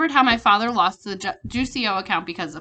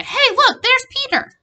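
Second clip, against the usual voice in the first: excited friends; 8 dB louder, slower than usual. Retold or imitated. Friends voiced seeing Peter.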